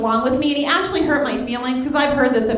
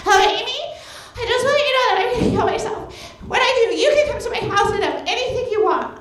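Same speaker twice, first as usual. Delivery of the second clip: high, fast, giggly put-on voice of a needy friend; mock the clingy friend, pitched way up; 10 semitones higher, faster than usual. Friend calling, acted quote.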